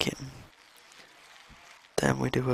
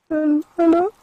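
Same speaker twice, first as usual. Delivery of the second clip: much higher, louder call with a rising end; high calling hello; 14 semitones higher, 7 dB louder than usual. Real, not acted. Player calling into the empty cave, high loud rising hello.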